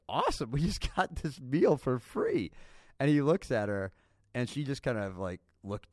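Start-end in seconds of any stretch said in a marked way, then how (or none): 0.1-2.5 a mock giddy husband, higher, slow and gleeful; ecstatic. Husband thinks the meal is free, imitated ecstatic glee. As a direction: gloat over the free meal in a light imitation. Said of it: "he was, like, ecstatic".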